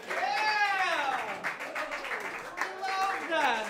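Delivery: high, drawn-out cheer; excited cheering. Real, not acted. Host hyping the open mic crowd, excited cheering.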